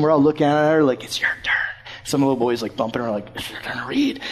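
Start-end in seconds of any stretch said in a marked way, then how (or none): 1.0-4.2 put-on hushed kids' whisper; whispered nudge. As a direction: whisper an urgent prompt to a classmate. Kids nudging shy girl in silence.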